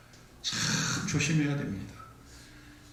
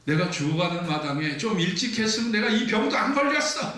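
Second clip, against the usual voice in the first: high, raised-voice outburst; mock outrage, climbing higher; indignant yelling; 5 semitones higher, 3 dB louder than usual. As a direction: act out a sick wife yelling in protest. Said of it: "소리를 칩니다".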